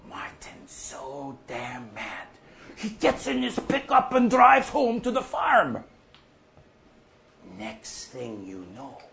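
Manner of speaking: usual speaking voice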